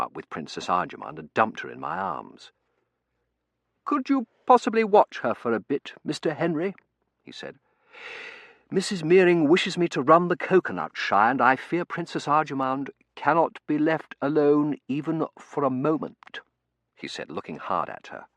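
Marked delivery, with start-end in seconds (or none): 3.9-6.7 high, formal butler voice; butler's request. Acted. Bain voiced in story. 8.7-16.4 high, loud butler character voice; formal butler, apologetic. Acted. Bain voiced in story.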